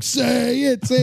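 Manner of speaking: high, strained mock shout; crowd egging on. Everybody else pressing the bingo winner, mock shouting. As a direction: urge it on like a rowdy crowd.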